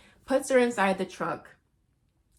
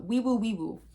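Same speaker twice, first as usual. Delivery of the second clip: siren imitation; 2 semitones higher than usual.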